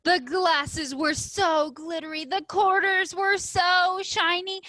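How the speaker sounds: a reader delighting in shiny things; dazzled. A narrator's reading. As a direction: sell the sparkle. Lily admiring her treasures.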